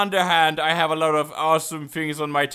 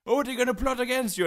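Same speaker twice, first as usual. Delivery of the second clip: quick, higher-pitched tip-off; mock alarmed warning; 6 semitones higher, faster than usual. Warning him of plotters, mock alarm. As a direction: blurt it out as a hurried warning. Acted quote of worried advisers.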